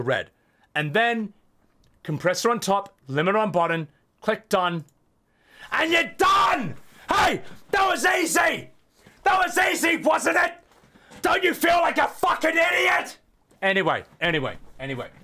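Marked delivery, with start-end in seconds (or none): 5.7-13.2 higher sarcastic shout; mock cheerful yelling. Streamer gloating at viewer, sarcastic shouting.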